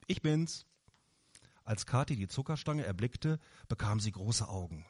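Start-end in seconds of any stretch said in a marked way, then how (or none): 0.1-0.7 put-on high, louder little girl's call. A narrator's reading. Girl bursts into the shop calling grandpa, high and loud. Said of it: "hüpfte durch den Laden und rief".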